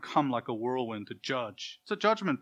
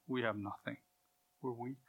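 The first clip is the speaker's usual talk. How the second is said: despondent; 2 semitones lower, 10 dB quieter than usual. Acted. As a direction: let the voice sink away, helpless. Voicing Elisha's despair at loss.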